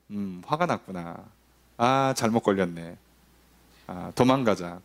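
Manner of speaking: low-pitched, subdued thinking aloud; wary muttered realization. Acted. Child sizing up an angry parent, low wary aside. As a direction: drop the voice low, as if thinking to yourself.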